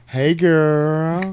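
put-on high, drawn-out "girl" greeting; mom from Friday. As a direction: greet her like an over-friendly movie mom. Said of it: "I sounded like the mom from Friday".

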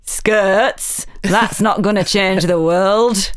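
slow, broad Yorkshire accent, a mock dismissive dad; stepfather's Yorkshire accent. Quoting her stepfather scoffing at fashion, in Yorkshire accent. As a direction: drawl it in his Yorkshire accent, unimpressed. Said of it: "He's got a great accent like that".

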